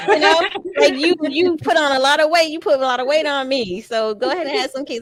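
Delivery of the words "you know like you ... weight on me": high, loud laughing complaint. Real, not acted. Mother laughing while blaming her daughter for the weight, high and giggly. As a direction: laugh through the accusation.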